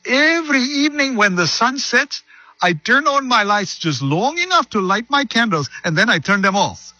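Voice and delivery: put-on high Irish old lady voice; high lilting Irish woman's voice; Irish accent. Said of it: "That's my Irish Indian accent".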